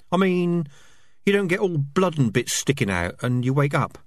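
squeaky rat chatter. Acted. Rats voiced in dialogue.

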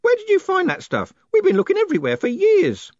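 put-on very high, loud excited old man. A narrator's reading. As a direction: demand it in high-pitched excitement. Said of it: "said Groat excitedly".

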